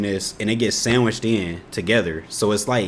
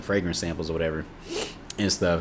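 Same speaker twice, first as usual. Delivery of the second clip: ordinary talk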